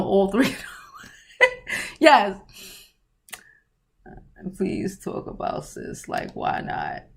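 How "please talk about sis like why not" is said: unvoiced, low whisper; whispered read. Reading a viewer's comment out in a whisper. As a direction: breathe the words out.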